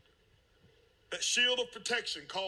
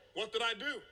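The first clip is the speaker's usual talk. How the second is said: normal talking voice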